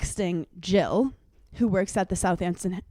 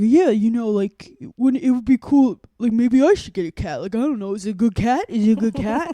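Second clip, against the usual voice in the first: louder, eager imitation of a friend; imitating Aiden; 2 semitones higher, 5 dB louder than usual. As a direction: play the keen friend asking about the cat. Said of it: "exactly how the conversation went".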